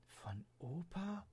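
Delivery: soft, slow, high puzzled question; quietly puzzled girl asking back; soft high puzzled rise; wondering. Acted. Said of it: "wunderte sich Leonie".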